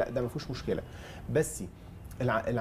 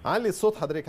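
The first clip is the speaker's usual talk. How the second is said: louder and higher over a bad line; to someone hard of hearing; 6 semitones higher, 5 dB louder than usual. Real, not acted. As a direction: speak up for the caller. أنا مش سامعة كويس.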